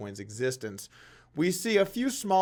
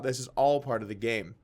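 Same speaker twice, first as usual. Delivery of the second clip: normal talking voice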